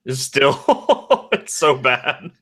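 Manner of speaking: laughing through the words; giggling at a glitchy call. Real, not acted.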